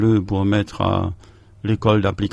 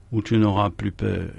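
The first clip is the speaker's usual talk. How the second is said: usual speaking voice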